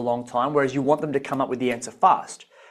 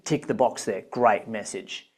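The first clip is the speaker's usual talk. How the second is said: usual speaking voice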